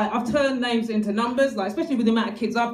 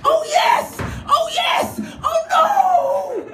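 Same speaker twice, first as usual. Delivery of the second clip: very high moaning oh yes, then oh no; high hammed-up passion turning to dismay; mock ecstatic cries; 14 semitones higher, 3 dB louder, much slower than usual.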